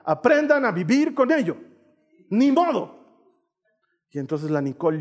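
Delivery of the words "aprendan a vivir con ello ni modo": raised, scolding dad's verdict; higher-pitched mock fatherly telling-off; stern father. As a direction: lay down the law as a parent. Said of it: "he tenido que hacer de papá".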